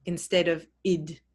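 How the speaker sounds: normal talking voice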